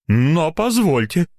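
slow, high-pitched protest; offended. A narrator's reading. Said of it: "обиделся доктор".

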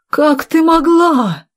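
high, drawn-out wounded reproach; shocked reproach. Acted. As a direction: stretch it out, appalled. Betrayed villain reproaches her. (потрясённый упрёк, нараспев)

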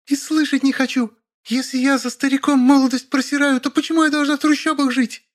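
high-pitched outraged female voice; hysterical refusal. A narrator's reading. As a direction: argue back in falsetto indignation. Acted quarrel, hysterical young wife. (истерично, высоким женским голосом)

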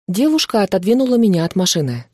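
ordinary delivery

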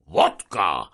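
loud roar with a falling end; bellowed demand. A narrator's reading. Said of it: "ordította aztán minden átmenet nélkül". (hangosan, követelőzve ordítva)

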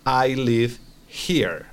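slow, careful model of I live here; slow model sentence. Shown as a demonstration. Teacher modelling for student, slow and careful. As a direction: spell it out slowly for the student. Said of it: "se va a decir".

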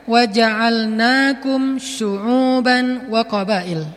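slow, chanted Arabic recitation; Quranic recitation. Acted. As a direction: recite the verse slowly, as in prayer. (lantunan ayat Al-Qur'an yang pelan)

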